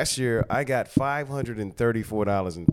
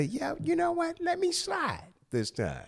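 imitating himself; 5 dB quieter than usual. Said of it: "I say".

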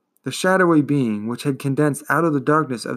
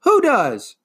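high, loud scream; 11 semitones higher, 6 dB louder than usual. A narrator's reading. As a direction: scream the question. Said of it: "Atreyu screamed even louder".

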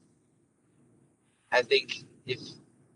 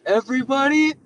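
very high, loud cry of dawning horror; mock horrified child; 12 semitones higher, 8 dB louder than usual. Five-year-old learns everybody dies, hammed-up horror. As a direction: wail it high like a horrified little kid. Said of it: "like, horrifying".

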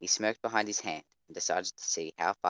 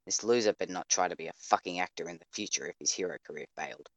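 usual speaking voice

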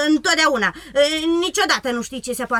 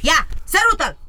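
loud, higher, bossy shout; 6 semitones higher, 5 dB louder than usual. Acted. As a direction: yell it like a bossy robber girl. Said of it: "A strigat ea". (strigăt poruncitor)

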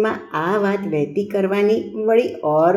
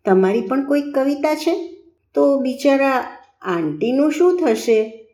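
higher-pitched, worried innocent question; innocent concern; 6 semitones higher than usual. A narrator's reading. Pupil worrying about the tutor's wife, innocently concerned. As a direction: ask naively, slowing with concern at the end. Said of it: "ચિંતિત પપ્પુએ નિર્દોષ પ્રશ્ન કર્યો".

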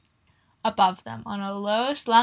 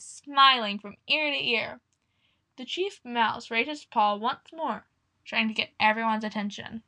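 usual speaking voice

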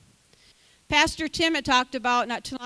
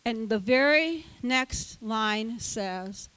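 ordinary talk, nothing marked

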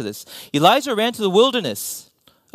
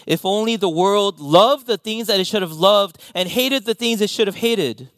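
ordinary delivery

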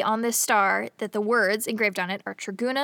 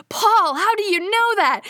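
astonished question; 10 semitones higher, 5 dB louder than usual. Retold or imitated. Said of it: "And Angela Lansbury's like".

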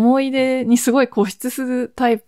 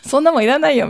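higher, brushing-off retort; dismissive sister; 6 semitones higher, 4 dB louder, much faster than usual. Retold or imitated. The sister brushes off her older sister's question, dismissive. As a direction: wave it away as junk. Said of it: "みたいな感じで".

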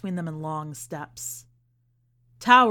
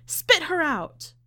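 screamed demand; 9 semitones higher, 4 dB louder, slower than usual. Acted. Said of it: "she screamed".

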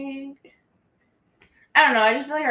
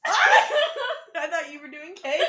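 laughing; 6 semitones higher, 7 dB louder, slower than usual. Real, not acted. Laughing after burp.

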